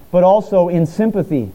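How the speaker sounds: ordinary talk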